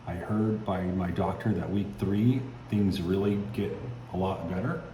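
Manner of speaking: ordinary talk, nothing marked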